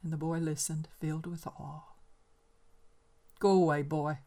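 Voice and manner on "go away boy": put-on loud, curt order to the boy; old man sends the boy off; gruff dismissal. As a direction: push the boy away. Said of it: "said the man".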